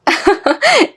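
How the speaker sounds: high-pitched female giggle; amused laugh. Acted. Young woman laughs at his old-fashioned word, high giggle.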